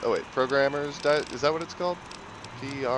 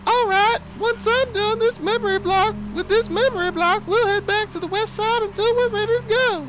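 high woman voice; 6 dB louder than usual. Pretend. Voicing Pauline.

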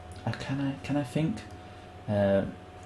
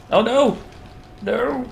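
high panicked cry of alarm; panicked yelp; 13 semitones higher, 5 dB louder, slower than usual. Real, not acted.

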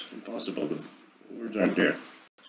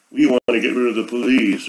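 higher and louder, a jeering parody of their slogan; mocking quote; 5 semitones higher, 6 dB louder than usual. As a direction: sneer it out as the opponents' line. Mocking his opponents' slogan.

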